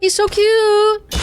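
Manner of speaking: high, slow, squealing coo; cute anime streamer. Real, not acted. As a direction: gush over it like a cute plushie. Cooing over cute bird.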